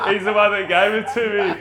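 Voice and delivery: high, loud laugh and protest. Real, not acted. Host laughing as the guest hands him credit, loud and high.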